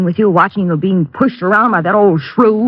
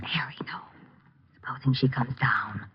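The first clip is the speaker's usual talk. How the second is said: frightened hushed whisper; alarmed whisper to her lover; 5 semitones lower, 15 dB quieter than usual. Acted. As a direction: keep it down, she might hear. Afraid wife will overhear.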